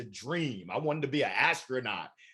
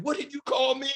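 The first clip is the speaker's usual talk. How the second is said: mock offended; 11 semitones higher than usual. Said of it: "I said".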